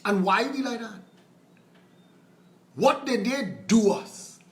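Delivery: usual speaking voice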